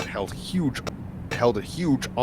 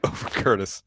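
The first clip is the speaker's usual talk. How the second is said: plain reading voice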